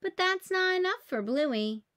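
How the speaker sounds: usual speaking voice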